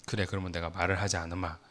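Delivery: low, soft, quick retold thought; inner grudging resolve. Dad silently deciding not to talk to his child, grudging inner voice. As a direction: mutter it inwardly, mind made up. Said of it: "제가 속으로 어떤 마음이었을까요".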